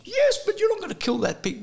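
high, laughing mock of an eager shopper; eager woman. Voicing the woman keen for a free sausage, high and laughing.

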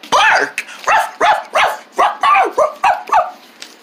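high playful yapping barks; squeaky pretend barks with a laugh; pretend dog barking. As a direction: bark like a happy puppy. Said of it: "Say bark".

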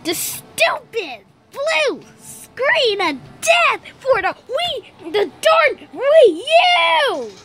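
slow, emphatic frustration; exasperated. Acted. Player cursing the blue screen of death, slowly fuming.

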